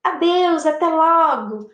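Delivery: high, lilting goodbye; sing-song parting; farewell. Shown as a demonstration. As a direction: call goodbye, slowing on the last words.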